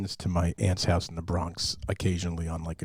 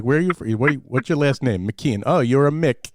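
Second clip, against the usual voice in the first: put-on louder, higher, blunt old Italian uncle grilling a kid; 6 semitones higher, 9 dB louder than usual. Uncle grilling Owen about his last name, loud and blunt. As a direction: bark the questions like a nosy old uncle. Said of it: "he's like".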